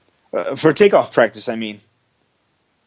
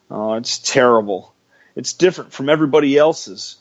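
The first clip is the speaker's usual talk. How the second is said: ordinary delivery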